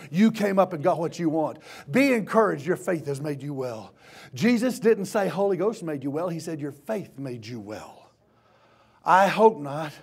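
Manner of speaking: normal talking voice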